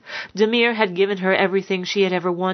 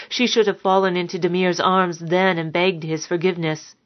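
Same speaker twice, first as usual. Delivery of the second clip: usual speaking voice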